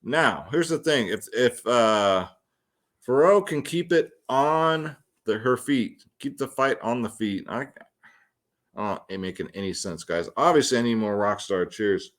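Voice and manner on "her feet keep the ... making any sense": ordinary talk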